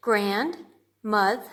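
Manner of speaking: stretched out slow, syllable by syllable; said slowly. Shown as a demonstration. Teacher showing grade two how to say it, slowly. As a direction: draw the word out so each syllable lands. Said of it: "you say the word slowly".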